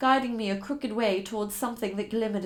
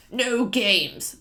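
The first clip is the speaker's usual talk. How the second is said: a touch louder, menacing captor; captor's warning; 6 dB louder than usual. Acted. Captor warning his captive, lightly menacing. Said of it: "my captor warned".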